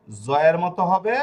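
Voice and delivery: জয়ের begun with a buzzing "z"; z sound. Shown as a demonstration. Teacher recapping three sounds of S, জয়ের with "z". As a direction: stretch জয়ের slowly from a clear "z". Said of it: "এই তিন ধরনের উচ্চারণ".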